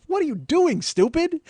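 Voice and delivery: high-pitched mock indignation; exasperated self-scolding. Man scolding himself over calling her baby, exasperated. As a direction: berate yourself, hammed-up and appalled.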